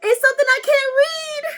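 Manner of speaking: high, loud cry of frustration; real exasperated yell; exasperated shout. Real, not acted. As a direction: wail it at the card. Frustrated at unreadable card.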